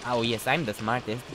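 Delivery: ordinary delivery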